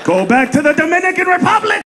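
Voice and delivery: high, rising indignant squeal; mock outraged cop. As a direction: catch them out, high and scornful. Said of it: "they don't wanna be like".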